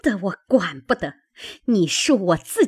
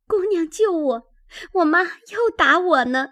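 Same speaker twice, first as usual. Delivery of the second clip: put-on high-pitched frightened girl's plea; pleading for help; 10 semitones higher than usual. A narrator's reading. Girl clinging to a maid, pleading for rescue from mother. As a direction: beg for rescue in a high young voice.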